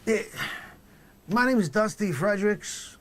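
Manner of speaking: loud, high, put-on dealer; druggy dealer voice. Dealer witness introducing himself on the stand, loud put-on voice.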